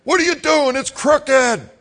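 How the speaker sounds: hammed-up angry father scolding boy; imitating angry dad. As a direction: yell like a furious father. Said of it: "He's like".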